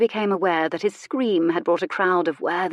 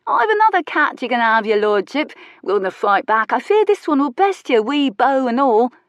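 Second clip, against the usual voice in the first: teasing workman; 6 semitones higher, 6 dB louder than usual. Acted.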